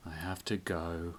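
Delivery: gloomy. Acted. Gloomy Melvin leaving.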